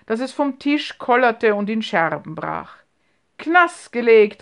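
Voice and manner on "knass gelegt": loud, hearty call, slowed; loud laughing call. A narrator's reading. Uncle calls out across the table at broken glass, loud and hearty. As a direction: boom it out with glee. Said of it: "rief Onkel Markus laut lachend".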